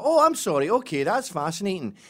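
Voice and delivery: feigned fascination in a Scottish lilt; Scottish tourist. Pretend. Foreigner faking interest to the dancers, high Scottish accent. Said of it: "And you go".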